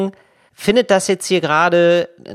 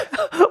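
high giggle. Real, not acted. Laughs at own story.

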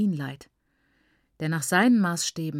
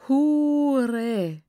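long, high, loud wail of grief; moaning cry from a stricken father; anguished groan; 8 semitones higher, 5 dB louder than usual. A narrator's reading. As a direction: groan the word out, drawn out and raised. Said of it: "stöhnte mein Vater auf".

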